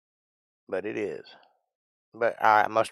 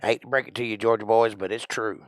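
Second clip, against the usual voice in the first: ordinary talk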